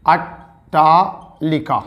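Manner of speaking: each syllable slowly drawn out; slow dictation. Shown as a demonstration. Teacher dictating numbers to students, slow and drawn out. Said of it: "एक शब्द होता है".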